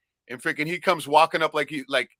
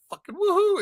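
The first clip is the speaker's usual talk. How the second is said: excited woohoo. Retold or imitated. Retold excited cheer.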